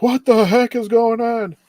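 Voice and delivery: high, loud, drawn-out mock panic; mock freak-out. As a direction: imitate a scientist freaking out. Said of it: "he's, like, freaking out too".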